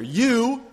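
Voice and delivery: "You" said alone, high and loud; stress on You. Shown as a demonstration. Preacher pointing at the hearers, loud stressed "You". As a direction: throw "You" out like an accusation. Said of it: "this explicit pronoun twice there".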